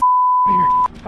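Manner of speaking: high, loud, slow shriek; panicked scream. Real, not acted. Panicked fleeing.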